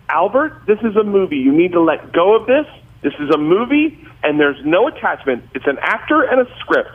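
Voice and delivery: high-pitched imitation of a scolding wife; exasperated mom. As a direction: set your husband straight in a mom voice. Acts out mom scolding dad.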